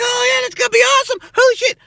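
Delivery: very high, fast giddy squeal; mock excited fans. Vlogger imitating hyped fans, fast and very high. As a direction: gush like a thrilled fan. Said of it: "Everybody's like".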